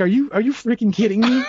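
incredulous. Retold or imitated. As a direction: raise the pitch in mock disbelief. Retells disbelief at frat photo.

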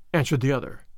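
usual speaking voice

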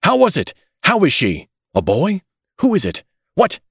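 high, frantic string of questions; gasping rush. A narrator's reading. Father-to-be rushing up to the doctor, frantic and urgent. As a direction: fire off anxious questions one after another. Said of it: "as he came up in a gasping rush".

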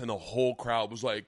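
ordinary talk, nothing marked

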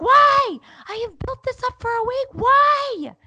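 very high, loud shrieked protest; losing it. Retold or imitated. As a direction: wail the why at full pitch. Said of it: "I just lose it".

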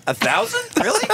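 shocked disbelief. Retold or imitated. Shocked at the price.

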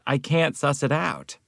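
ordinary delivery

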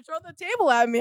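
loud, high taunting dare; daring. Retold or imitated. Student daring classmate to throw the table, taunting. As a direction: egg him on, re-enacted.

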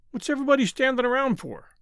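put-on high, quick child's question; puzzled little boy. A narrator's reading. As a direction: blurt it out in a fast kid voice. Puzzled boy asks the family.

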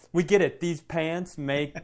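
usual speaking voice

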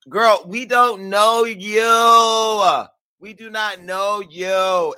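loud, high, drawn-out put-down; exasperated shout. Real, not acted. Host to the model who claims fame, exasperated holler. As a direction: holler it, stretching the words out slowly.

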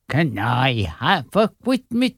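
lisping every s sound sideways; lateral lisp. Acted. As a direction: slur the s sounds with a wet lisp. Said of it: "I like the lateral lisp you throw in".

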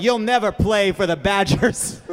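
hammed-up sports-movie villain, drawn out; lightly voiced villain, slow and emphatic. Villain telling girl she can't play football, mock menace. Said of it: "a villain is telling a girl she can't be on".

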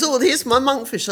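high, whiny imitation of a friend; high mocking impression. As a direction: mimic her fussing. Mimicking friend.

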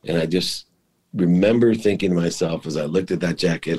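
ordinary talk, nothing marked

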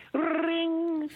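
high, sustained trilled ring; phone ring. Retold or imitated. Actor imitates the phone ringing as he tells his story, phone ring. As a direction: imitate a telephone ringing on one high note. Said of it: "The phone rings".

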